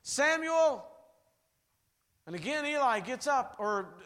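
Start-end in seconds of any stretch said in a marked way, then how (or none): 0.0-0.8 high, loud repeated calls; calling out. Retold or imitated. God calling Samuel again, loud and high. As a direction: call the name twice, reaching further. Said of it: "again the voice calls".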